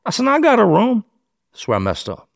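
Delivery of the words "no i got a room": high-pitched defensive comeback; retold defensive answer to a needling detective; indignant retort.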